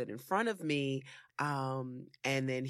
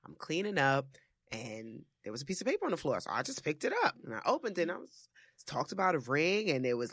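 ordinary talk, nothing marked

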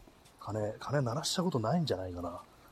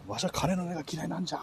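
put-on creaky wise old man; 8 semitones higher than usual. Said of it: "裸足の弦ですね".

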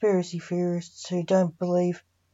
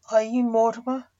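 a touch of fear, slightly higher voice; terrified question; 4 semitones higher, 3 dB louder than usual. A narrator's reading. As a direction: ask it as a mildly frightened character. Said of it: "asked the terrified".

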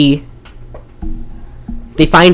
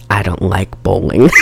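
low-pitched heroine imitation; lightly acted seductive reply; mock sultry; 6 semitones lower than usual. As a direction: play the film line low and knowing. Acting out heroine's deadpan reply.

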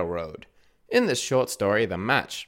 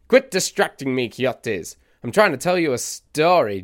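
irritated scolding; 4 semitones higher, 5 dB louder than usual. Acted. Scolding the listeners.

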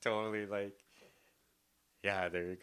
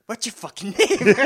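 exasperated; 8 semitones higher, 9 dB louder than usual. Retold or imitated. Retold exasperated question.

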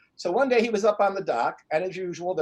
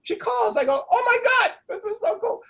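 high, loud burst of delight; thrilled; 5 dB louder than usual. Retold or imitated. Coach recalls her unexpected phone call, thrilled. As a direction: relive it at top excitement, voice leaping up.

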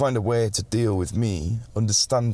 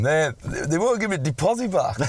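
ordinary talk, nothing marked

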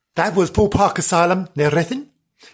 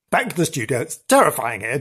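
normal talking voice

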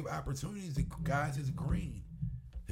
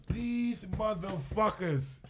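slow, drawn-out exasperated "These motherfuckers"; disbelief; 7 semitones higher, much slower than usual. Retold or imitated. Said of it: "I couldn't believe it".

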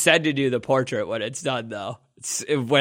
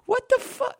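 high-pitched shocked outburst. Retold or imitated. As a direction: squeal it in disbelief. Recalls seeing his bad portrait.

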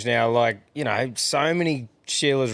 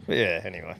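ordinary talk, nothing marked

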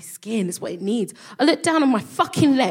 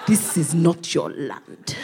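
slow low Nigeria voice; 4 semitones lower, much slower than usual. Retold or imitated. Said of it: "hear Nigeria going".

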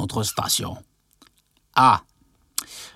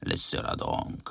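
whisper; 11 dB quieter than usual. Acted. Aside to the other man.

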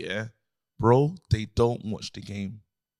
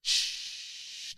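unvoiced shush; 9 dB quieter than usual. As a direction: hush the crowd. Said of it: "away fans will be like".

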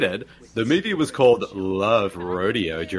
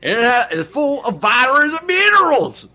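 mock crazy local; 12 semitones higher, 4 dB louder than usual. Mocking a movie character.

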